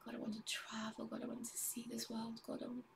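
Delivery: soft, hushed pleading prayer to God; whispered prayer. Real, not acted. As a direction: pray under the breath, intimate and earnest.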